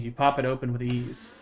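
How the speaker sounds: even, measured read-aloud of the story text; game text narration. Acted. Streamer reading game text to chat, steady narration. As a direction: read the scene out steadily to viewers.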